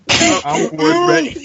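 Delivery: high, slow, laughing through it. Pretend. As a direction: stumble out the name while cracking up. Mocking Floyd, breaking into laughter.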